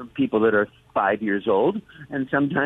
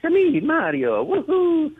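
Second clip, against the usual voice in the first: high, bouncy Italian plumber voice; cheerful cartoon plumber, big and springy; Mario voice; slower than usual. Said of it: "you talk about me saying".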